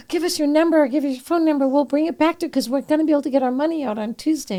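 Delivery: high-pitched, eager rush of relief; flustered gratitude. Retold or imitated. Promising kind strangers to repay them, high and flustered. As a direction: gush it out high and insistent, imitating your younger self.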